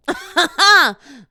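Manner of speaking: loud burst of laughter, falling; big laugh. Real, not acted. Laughing at the idea of climbing wet rocks, loud burst.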